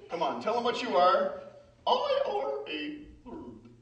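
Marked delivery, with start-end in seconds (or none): 1.9-3.7 puppet bird voice. Acted.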